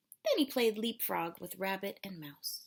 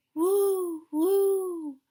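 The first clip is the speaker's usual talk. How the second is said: rising ghostly woo of the wind; wind howl; 9 semitones higher, 9 dB louder than usual. A narrator's reading. Wind sound in story.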